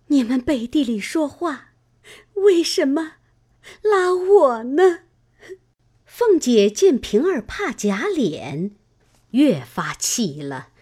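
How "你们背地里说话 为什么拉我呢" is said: high, weeping plea that slows at the end; a sob dragging out the last words; crying. A narrator's reading. Ping'er cries to her master and mistress, high and weeping. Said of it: "哭道".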